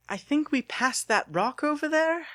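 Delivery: put-on high, unsure young man's voice; Lucas lost in dark.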